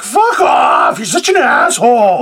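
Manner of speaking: host imitates Victoria rejecting his kind message, mock shout; mock angry outburst. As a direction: bark it like a friend rejecting a compliment. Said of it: "And she's like".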